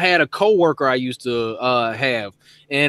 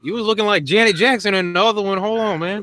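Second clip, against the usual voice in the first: hammed-up double take, pitched higher; mock shock; 4 semitones higher than usual. Guy meeting his online date, playing mock shock. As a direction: voice jumps up in pretend disbelief at the date. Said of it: "I'd be like".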